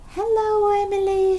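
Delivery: high, loud greeting shout. Acted. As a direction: shout hello across the sand. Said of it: "shouted Peppa".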